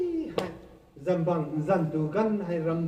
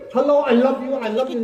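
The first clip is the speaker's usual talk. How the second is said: caller on the phone; 4 semitones higher, 9 dB louder than usual. Retold or imitated. Acting out a phone call.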